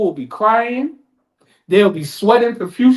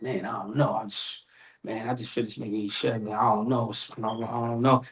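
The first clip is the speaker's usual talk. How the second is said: hesitant mumble; 12 semitones lower, 12 dB quieter than usual. Acted. Sinner hesitating, voiced.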